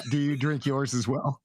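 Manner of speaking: ordinary talk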